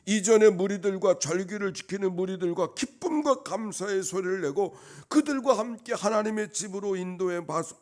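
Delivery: loud, high, impassioned pulpit cry; full-force preaching. Real, not acted. Preacher recalls singing freely before God, full-force preaching. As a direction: pour out the memory at full voice.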